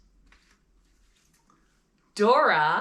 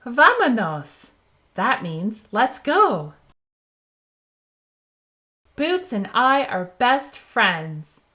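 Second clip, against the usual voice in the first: bright, animated picture-book read-aloud, louder; reading to children; 3 dB louder, slower than usual. Acted. Host reading a Dora book to young viewers, animated and bright. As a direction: read it out big and cheerful for little ones.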